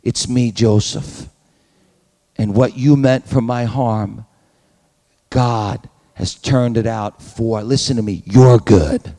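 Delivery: tender reveal. Pretend. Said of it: "the power and the strength and the grace to say".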